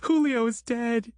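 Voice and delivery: high, slow wail of grief. Acted. Christine tells Grant that Julio is dead, wailing high and slow. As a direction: let the grief break out of her. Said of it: "she wailed".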